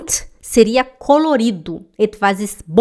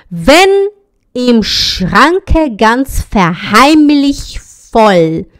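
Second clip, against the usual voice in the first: "Wenn im Schranke ganz verheimlich voll" very slowly, syllable by syllable; slow model reading; 5 dB louder, much slower than usual. Shown as a demonstration. Teacher models the German sentence slowly for students. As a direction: read it out very slowly for the class to copy.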